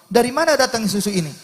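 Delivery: high-pitched puzzled question; bewildered. Retold or imitated. Husband finds milk at home, bewildered. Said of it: "bingung sang suami".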